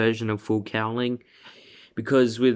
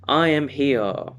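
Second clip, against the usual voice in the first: heroic declaration; 5 semitones higher, 4 dB louder, slower than usual. Acted. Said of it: "Deku's just like".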